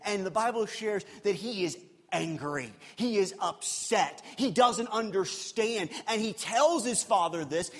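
ordinary delivery

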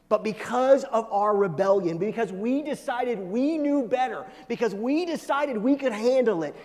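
high, emphatic, forceful sermon delivery; impassioned preaching. Real, not acted. Preacher blames our rebellion before the congregation, impassioned preaching.

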